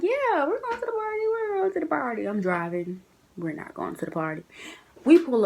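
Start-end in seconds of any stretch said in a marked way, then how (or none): 0.0-2.2 humouring. Retold or imitated. Humouring drunk roommate.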